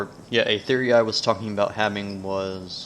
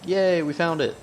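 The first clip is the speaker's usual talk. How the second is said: high, bright little cheer; happy yelp of relief; excited cheer; 8 semitones higher, 4 dB louder than usual. Real, not acted. Cheers on finding the path.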